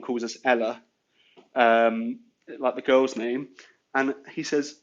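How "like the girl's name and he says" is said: usual speaking voice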